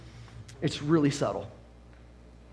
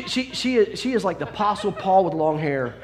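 usual speaking voice

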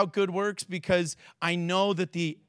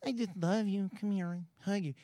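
soft, syrupy mock love; mock tender; 9 dB quieter than usual. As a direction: coo it like a sappy hug. Mocking soft huggy God.

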